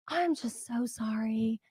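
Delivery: hushed, slow whispered apology; sweet Southern sympathy. Retold or imitated. Phone rep consoling a worn-out customer, sweet whispered sympathy. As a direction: imitate a sugar-sweet rep, nearly whispering. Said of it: "She had the cutest Southern accent".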